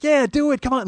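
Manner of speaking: high, loud imitation of cheering friends; excited egging-on. Her friends pushing her to accept, excited. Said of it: "they're all, like, s-, excited".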